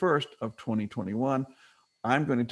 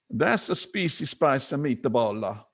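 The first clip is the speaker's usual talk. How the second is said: put-on slow, thick Italian accent; slower than usual. Man in a TV commercial tasting his meatball, thick Italian accent. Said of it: "with a thick Italian accent".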